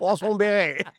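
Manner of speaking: mock French accent. Host joking to co-host about French names in a mock French accent. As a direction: announce "Croissant Beret" like a French stadium announcer.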